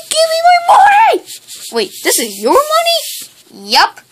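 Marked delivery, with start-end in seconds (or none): 0.1-1.2 much higher, loud yelled demand; furious shout. Acted. Kid yelling at the thief to return money, furious shout. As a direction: scream it at the thief.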